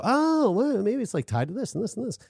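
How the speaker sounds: high, quick mock epiphany; mock realization. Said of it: "and you go".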